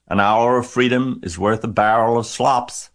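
high, loud honked plea; goose honking. A narrator's reading. Goose pleads freedom over slops, honking. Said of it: "honked the goose".

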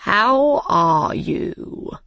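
very slow, drawn-out greeting; Cheshire Cat voice. Acted. Cheshire Cat greeting Alice, languidly drawn out. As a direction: stretch each word like a sly cat.

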